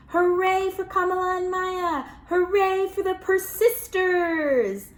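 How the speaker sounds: loud, high hooray, slowing on the last; cheering. Acted. Storyteller celebrating the kids for listeners, cheering loudly. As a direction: cheer them like a crowd.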